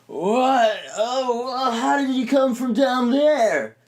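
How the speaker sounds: high, slow, puzzled little voice; a wide-eyed character squeaking in wonder; squeaky surprised character. Acted. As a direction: gape up at the stranger in a thin high voice. Second skit character, surprised.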